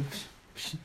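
breathy unvoiced psh psh; boxer dodge noises. Son dodging like a boxer, playful psh sounds. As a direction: puff it out like a boxer slipping punches.

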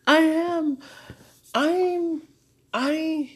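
high, very loud outburst; loud, distressed insistence. Real, not acted. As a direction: shout it out in halting bursts. Breakdown, saying what is needed.

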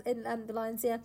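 ordinary delivery

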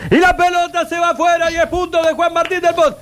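soaring, high sports call; excited tennis commentator; excited commentary. Retold or imitated. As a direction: belt it out like a goal call. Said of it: "cuando la gente gritaba".

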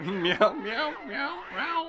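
high playful meows; cat meowing. Pretend. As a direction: meow like a cat. Cat noises for sound effects.

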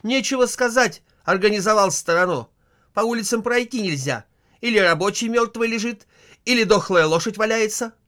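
high, loud scornful sarcasm; mock his fine organisation; wife scorns husband's running of the country, loud sarcasm; sarcastic wife. Wife mocks husband's rule.

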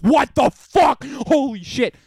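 slow, loud stunned outburst; loud slow mock shout of shock; shocked musicians. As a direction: react like amazed players. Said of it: "they're like".

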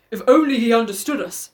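lightly put-on father's voice. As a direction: voice the wistful father, playfully. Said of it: "so I do a father voice".

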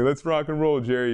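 teasing clients. Retold or imitated. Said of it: "make fun of you by saying it back".

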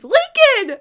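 high, loud, delighted yell of a name; excited scream. Retold or imitated. Girl answering her best friend's call, excited scream. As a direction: scream his name, thrilled he called. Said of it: "she, like, excitedly screams".

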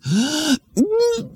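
high sharp intake of breath. Acted. Kid gulping air before the bathroom, high gasp. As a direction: suck in air before holding it.

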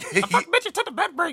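squeaky high mock rapper voice. Joking about bad rapper voices, squeaky high mock rap. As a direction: spit the bar in a silly high voice.

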